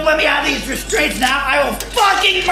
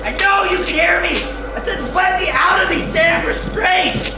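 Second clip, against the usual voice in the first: raging patient yelling to be released; enraged, desperate yelling; furious shouting; 6 semitones higher than usual. Acted. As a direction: scream at your captors to let you go. Restrained patient yells at staff.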